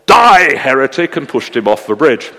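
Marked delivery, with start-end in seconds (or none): 0.0-1.2 sudden loud, high yell; furious story-villain outburst, easing quickly. Acted. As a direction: explode on the first word. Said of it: "At which Geordie yelled".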